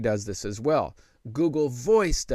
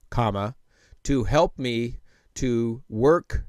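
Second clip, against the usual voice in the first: slow, measured dictation voice; slow, clear dictation; slower than usual. Shown as a demonstration. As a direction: dictate each word carefully to the phone. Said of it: "All right".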